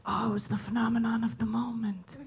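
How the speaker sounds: hushed, mock-reverent whisper. Audience member whispering about the show, hushed. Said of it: "you'll be like".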